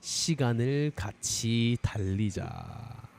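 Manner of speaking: each lyric phrase read fast with pauses between; lyric reread in phrases. Shown as a demonstration. Teacher rereads the long lyric in chunks for the class. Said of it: "long sentence".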